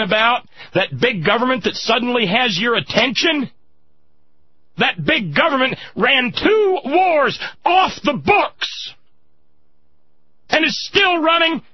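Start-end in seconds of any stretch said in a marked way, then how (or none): 4.8-11.6 angry cry. Real, not acted. Host raging at the war spending, near crying. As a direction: let it break with anger. Rant peaks.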